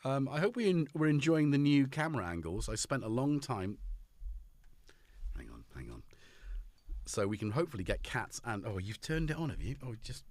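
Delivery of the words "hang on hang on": whisper. Real, not acted. Quiet aside off-mic.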